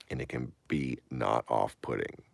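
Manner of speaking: ordinary talk, nothing marked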